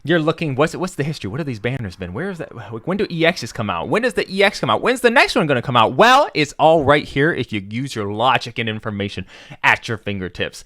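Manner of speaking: upbeat, loud sales pitch; infomercial voice. Pretend. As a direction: pitch it like a late-night ad host. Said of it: "Why am I talking like a sa-, uh, infomercial?".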